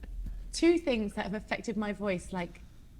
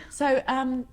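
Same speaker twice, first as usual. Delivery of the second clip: normal talking voice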